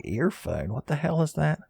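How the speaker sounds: mock-baffled viewer, rising sharply on the question; swooping mock disbelief; puzzled listener. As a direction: lightly imitate an incredulous listener. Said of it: "You're like".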